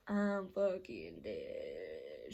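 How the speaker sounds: very low, slow groaned words; deep, dragging mock despair; mock doom. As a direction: drag it out low, as if doomed. Acted resigned reaction.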